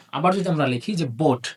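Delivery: normal talking voice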